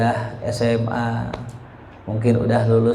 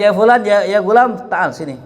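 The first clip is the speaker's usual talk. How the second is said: raised, higher summons, easing at the end; calling out; 7 semitones higher, 4 dB louder than usual. Retold or imitated. Imam Malik summons his student over, calling out. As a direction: call the student over, lightly acted.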